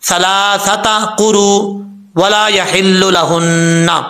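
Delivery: slow recited verse, measured and chanted; tajweed recitation; Quranic recitation. Shown as a demonstration.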